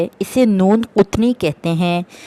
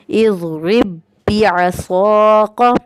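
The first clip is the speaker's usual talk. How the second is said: very slow, drawn-out Quranic recitation; much slower than usual. Shown as a demonstration. Teacher reading the first example to students, slow drawn recitation. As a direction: stretch every vowel as in tajweed. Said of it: "पहली मिसाल दी गई है".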